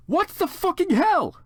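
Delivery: high, loud, slow angry outburst. Acted. Heroine demanding answers from Draco, slow high angry cry. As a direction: drag it out in high outrage. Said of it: "I asked angrily".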